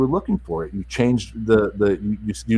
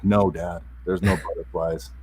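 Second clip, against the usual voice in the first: quieter and lower, deflated "there's no butterflies"; defeated; 6 semitones lower, 6 dB quieter than usual. Retold or imitated. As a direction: let "There's no butterflies" sink low and soft. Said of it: "I could feel she's just defeated".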